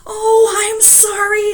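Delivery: high, slow, sorry little voice; a hint of a fretful wife apologizing; flustered wife. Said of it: "said poor Missus Wagon Wheel".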